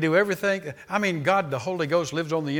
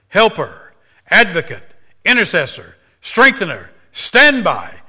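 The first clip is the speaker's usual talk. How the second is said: each title louder, pitch climbing; rising preaching list; 4 semitones higher, 11 dB louder than usual. Real, not acted. Preacher reading the titles, building.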